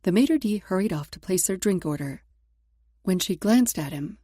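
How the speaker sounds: usual speaking voice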